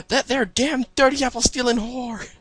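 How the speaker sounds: high, slow, hammed-up country fury; angry outcry. A narrator's reading. Applejack rails at the apple thief, angry outcry. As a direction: cry out in mock outrage with a Southern accent. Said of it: "shakes her in anger as she cries out".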